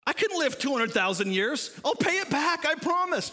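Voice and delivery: pleading servant, mockingly overdone; mock groveling plea. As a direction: ham up a desperate debtor's promise. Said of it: "doesn't that just sound noble".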